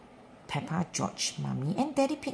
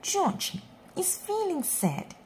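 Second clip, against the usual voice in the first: ordinary delivery, nothing marked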